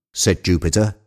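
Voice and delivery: usual speaking voice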